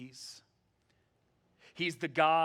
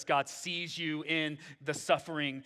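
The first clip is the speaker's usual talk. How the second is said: ordinary talk, nothing marked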